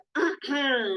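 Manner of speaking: pointed throat clear to hush someone; meaningful "ahem" at someone's indiscretion; warning throat clear. Shown as a demonstration. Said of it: "está cometiendo una imprudencia, uno dice".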